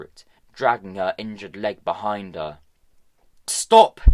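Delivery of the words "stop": high, loud yell to stop her; shout. A narrator's reading. Tom shouting after Hester to stop. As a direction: call her back at full voice. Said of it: "he shouted".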